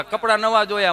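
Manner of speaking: ordinary talk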